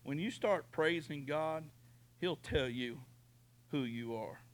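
normal talking voice

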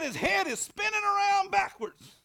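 high, loud hammed-up terror; mock panic. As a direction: squeal it like a terrified wife. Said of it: "She said".

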